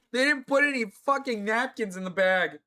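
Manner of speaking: high, loud outburst of frustration; exasperated complaint. Real, not acted. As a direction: complain loudly, really fed up. Outraged at missing napkins.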